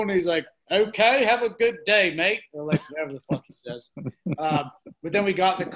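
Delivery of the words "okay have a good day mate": raised, louder mock British sign-off; terrible British accent. Imitating a British friend ending the chat, terrible British accent. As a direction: brush him off in bad British. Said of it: "Here's my terrible British accent".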